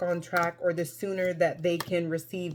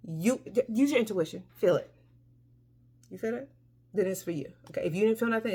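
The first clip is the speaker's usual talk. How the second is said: normal talking voice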